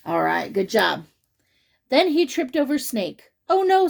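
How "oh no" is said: much higher, louder oh no; mock dismay. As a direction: gasp it out, hammed-up. Norman reacts to trip.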